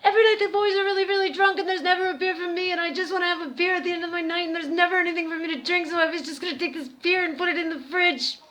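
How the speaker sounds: fast, high, loud rambling excuse; ridiculous ramble. Retold or imitated. Caught girl rambling excuses at the Scottish guy, rushed and high. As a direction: gabble the excuse out fast and breathless. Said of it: "went into some ridiculous ramble".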